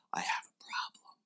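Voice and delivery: ashamed whisper; whispered confession. Retold or imitated. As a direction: admit it under your breath. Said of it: "Greg's like".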